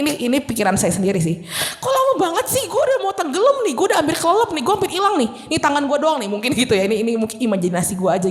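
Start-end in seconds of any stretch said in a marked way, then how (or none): 1.8-6.3 high whiny complaint while sinking; impatient Peter. Retold or imitated. Peter grumbling as he sinks, high and whiny. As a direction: complain it's taking too long. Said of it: "Si Petrus mungkin bisa mikir gini ya".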